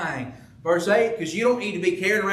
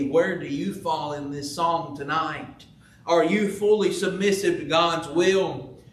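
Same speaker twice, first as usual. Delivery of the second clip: ordinary talk, nothing marked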